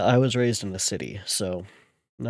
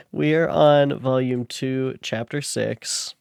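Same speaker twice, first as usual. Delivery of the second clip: announcer voice; 3 semitones higher, 7 dB louder, slower than usual. Acted. Announcing chapter to audience.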